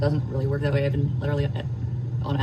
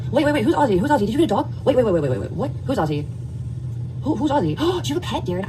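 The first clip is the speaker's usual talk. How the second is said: high, quick excited questions about the dog; real surprise, can't stop asking; 7 semitones higher than usual. Real, not acted. Spots a dog on stream.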